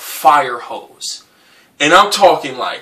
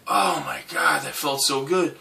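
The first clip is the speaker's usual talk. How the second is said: relieved; 10 dB quieter than usual. Retold or imitated. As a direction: let it out with relief. Relief after vomiting.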